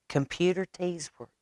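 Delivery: plain reading voice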